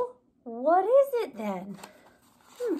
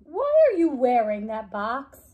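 ordinary delivery

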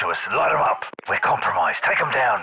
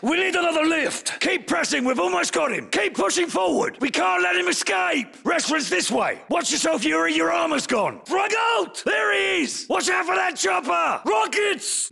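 battle shout; 8 semitones higher than usual. Acted. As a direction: roar orders over gunfire. Chase under fire.

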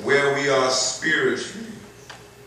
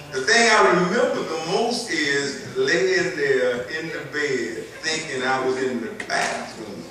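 animated preaching; 5 semitones higher, 5 dB louder than usual. Acted. Animated childhood story.

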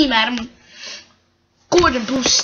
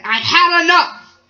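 cross outburst, higher and louder; 6 semitones higher, 5 dB louder than usual. Acted. Gordon answers the controller's scolding, crossly. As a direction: snap it out, fed up. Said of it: "He was so cross".

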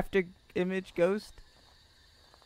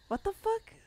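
horrified surprise. Real, not acted. Ghost disguised itself.

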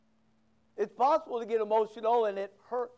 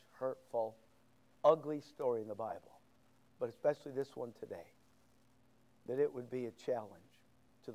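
closing prayer; 6 semitones lower than usual. Acted.

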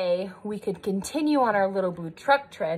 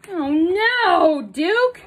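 mock dismay; 6 semitones higher, 5 dB louder, much slower than usual. Mock dismay to child about story.

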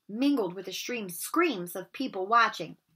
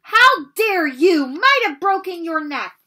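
very loud, slow, higher furious scolding; 8 semitones higher, 12 dB louder, slower than usual. Acted. McGonagall scolds Harry for the dangerous dive, furious. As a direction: tear into him. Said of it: "glasses flashed furiously".

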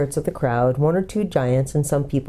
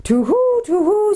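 very high flustered owl hoots; hooting owl; 4 dB louder, slower than usual. A narrator's reading. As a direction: hoot it right up high like an owl. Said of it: "hooting kind of voice".

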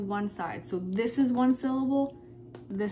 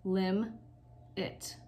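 LIM, long pause, softer IT; split into syllables; 4 dB quieter than usual. Shown as a demonstration. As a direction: say "lim" and "it" apart. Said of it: "the first syllable".

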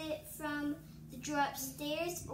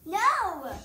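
loud protest; 4 semitones higher, 13 dB louder than usual. Real, not acted. Sister protests.

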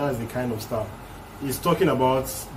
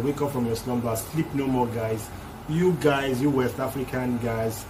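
ordinary talk

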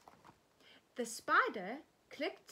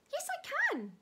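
high squeaky little mouse voice; frightened prey animal. Acted. Mouse answers the snail.

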